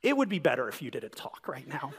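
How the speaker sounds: soft, gently pointed request; gentle. Retold or imitated. Wife in labor hushing her husband, softly and gently. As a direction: say it gently but firmly, hushed. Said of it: "looks up at me very gently".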